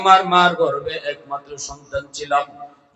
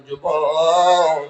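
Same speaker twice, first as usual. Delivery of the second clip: long drawn-out call; slow, sustained hailing cry; 6 dB louder, much slower than usual. Acted. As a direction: stretch the word out to hail someone. Preacher calls out to the youth.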